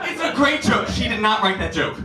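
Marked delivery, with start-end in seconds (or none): 0.0-0.9 mock shout. Repeated louder.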